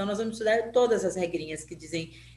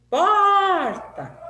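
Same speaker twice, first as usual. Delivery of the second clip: POR in "Porta" long, high and loud; "Porta" with the first syllable stretched and raised; calling out, POR prolonged; 10 semitones higher, 8 dB louder than usual. Shown as a demonstration. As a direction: holler "Porta" as if calling someone. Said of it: "como se essa palavra fosse uma pessoa que eu estivesse chamando".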